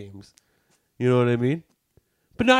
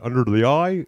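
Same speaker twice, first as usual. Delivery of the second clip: mock bingo caller; 3 semitones lower, 9 dB louder than usual. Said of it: "I don't think you need to be like".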